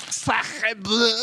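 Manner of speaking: mock French exclamation; french chef. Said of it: "It's the French experience".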